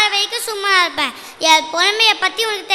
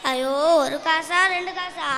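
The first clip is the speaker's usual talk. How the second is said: hushed awe; 3 semitones lower, 7 dB quieter than usual. Acted. Poet marvelling quietly at a thousand gold coins, hushed. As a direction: marvel under your breath at the fortune.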